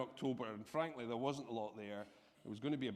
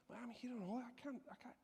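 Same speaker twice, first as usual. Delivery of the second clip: higher, softer panicked protest; panicked inner voice; 10 semitones higher, 10 dB quieter than usual. Retold or imitated. Guest panicking at the invitation, higher and softer. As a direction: squeak out a helpless refusal.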